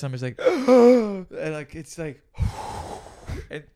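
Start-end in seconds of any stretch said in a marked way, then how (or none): 0.0-1.3 slow strained gasp. Retold or imitated. Smoke expanding in his chest, gasping. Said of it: "expand in my chest".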